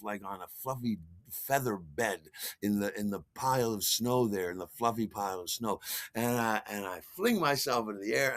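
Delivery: plain reading voice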